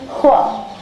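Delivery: "Hua" with a puff of H before the w; breathy H onset. Shown as a demonstration. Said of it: "a little bit of an H sound".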